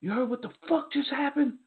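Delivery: high, strained mock scream; retold scream. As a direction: imitate a friend screaming the news, volume held back. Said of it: "screaming like".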